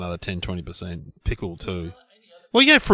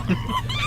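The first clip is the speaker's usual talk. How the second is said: monkey squeak; 3 dB quieter than usual. Pretend. Monkey reacts in the sketch.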